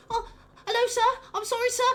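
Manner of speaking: put-on a nervous child eager to please; timid kitchen boy. Kitchen boy apologizing to the chef, high and timid. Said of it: "tiny eleven-year-old boy runs in and goes like".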